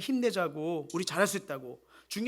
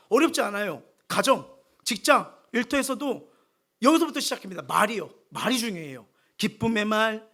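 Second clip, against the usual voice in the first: loud, fervent sermon voice, pushing each phrase out; preaching at full force; 3 semitones higher, 9 dB louder than usual. Acted. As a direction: proclaim it to the whole church. Preaching loudly to the congregation.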